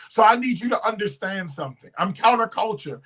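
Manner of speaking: usual speaking voice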